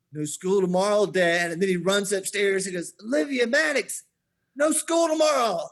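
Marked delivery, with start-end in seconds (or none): 0.1-1.5 put-on louder, raised voice of a pleased child; imitated smiling glee. Son tells dad he won the bet, gleeful. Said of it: "and then he smiles". 3.0-5.6 high, loud triumphant holler; imitated calling out. Winning boy calls to his siblings upstairs, hollering.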